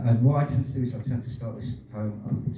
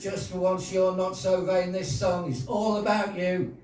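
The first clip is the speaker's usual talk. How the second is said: higher-pitched, ringing verse delivery; poem recital; 10 semitones higher than usual. Acted. Poet closing a poem for the audience, high-pitched recital. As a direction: deliver the rhyming lines as a performance.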